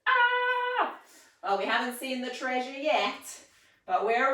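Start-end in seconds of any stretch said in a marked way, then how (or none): 0.1-0.9 high playful mock scream; a loud, high, hammed-up "Ah!"; pretend scream. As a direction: squeal like you spotted treasure. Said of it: "don't forget to scream".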